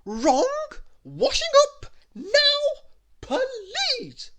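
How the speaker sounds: very high full yell, words drawn out; shrieked, slow bossy command; Little Miss Bossy. A narrator's reading. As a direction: yell the order word by word. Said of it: "ordered Little Miss Bossy".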